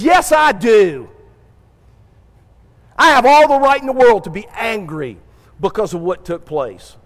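defiant Jonah. Retold or imitated. Jonah insists to God on his right to anger. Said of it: "He says".

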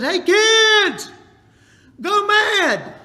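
put-on high shouted call to the kids. Dad shouting to his kids at the bus, cheerful call. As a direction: yell it across the yard.

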